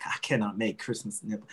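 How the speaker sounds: a lightly put-on Southern lady, fanning herself; Southern accent. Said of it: "I don't know why she has a Southern accent".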